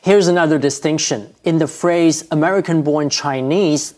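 normal talking voice

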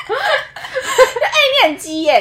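normal talking voice

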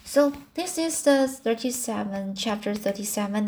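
plain reading voice

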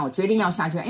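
ordinary delivery

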